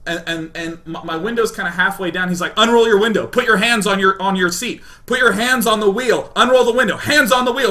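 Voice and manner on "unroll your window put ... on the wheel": loud, high shouted police orders; annoyed cop. Retold or imitated. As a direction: bark commands at the driver. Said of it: "a really annoyed police officer on a megaphone".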